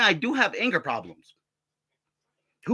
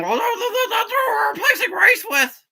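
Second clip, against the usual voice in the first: much higher, louder mocking voice; mimicking him; 5 dB louder than usual. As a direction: parody him, squeaky and loud. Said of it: "And then he starts saying".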